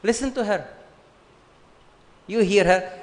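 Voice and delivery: usual speaking voice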